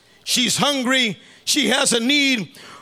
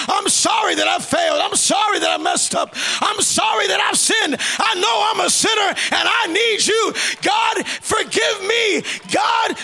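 high, fervent prayer building to a peak; crying out to God; 8 semitones higher, slower than usual. Acted. Sinner begging forgiveness, crying out to God. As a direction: plead higher and higher, stretching the last cries. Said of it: "begin to cry out to God".

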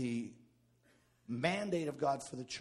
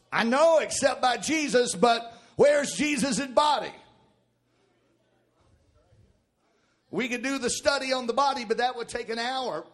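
preaching; 10 semitones higher, 12 dB louder than usual. Acted. Preaching at full force.

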